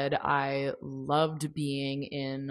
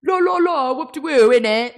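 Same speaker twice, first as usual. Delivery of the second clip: high, loud cartoonish British gibberish; exaggerated British mimicry, pitched way up and loud; mock British accent; 10 semitones higher, 11 dB louder than usual. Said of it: "hear people talking British accents".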